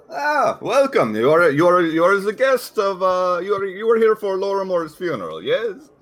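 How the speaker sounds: high, loud, hesitant foreign innkeeper. Acted. Innkeeper greeting the party with a stammering welcome. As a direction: stammer a big welcome to the guests.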